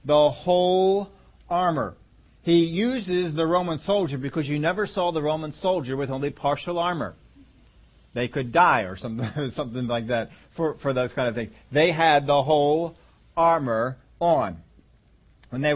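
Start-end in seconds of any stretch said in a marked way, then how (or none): 0.0-2.0 "whole" stretched out slowly, then "armor" after a pause; emphasis on WHOLE. Shown as a demonstration. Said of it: "The emphasis is on". 11.7-14.6 slow, "whole" held, pauses before "armor" and "on"; emphasis on WHOLE. Shown as a demonstration. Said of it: "The emphasis is on".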